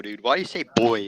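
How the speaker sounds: usual speaking voice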